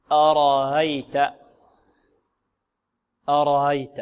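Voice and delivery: أرأيت with the second hamza eased, between alif and ya. Shown as a demonstration. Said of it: "في رواية الإمام قالون نقول". (أرأيت بتسهيل الهمزة الثانية بين بين)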